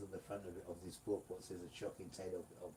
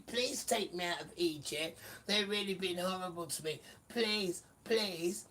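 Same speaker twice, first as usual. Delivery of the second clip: a hint of whining, pleading children; mock begging children; 10 semitones higher, 7 dB louder than usual. Preacher voicing the begging children, mock pleading. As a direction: mimic them begging, high and loud. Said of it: "crying and begging".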